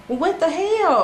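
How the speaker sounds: high, loud disbelief; shocked outburst. Retold or imitated. Retold shock at the attack news.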